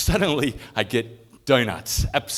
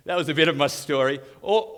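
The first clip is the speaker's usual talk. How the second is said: ordinary delivery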